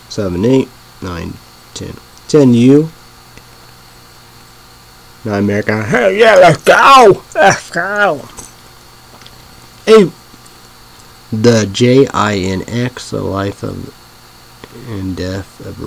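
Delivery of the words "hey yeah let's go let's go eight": high, loud cheering; excited shout. Real, not acted. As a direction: yell it like a fan celebrating. Cheering his pick on the list.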